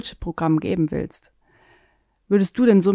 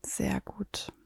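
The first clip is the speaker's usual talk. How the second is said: whisper; 14 dB quieter than usual. Acted. Whispered praise in meditation.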